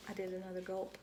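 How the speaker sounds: plain reading voice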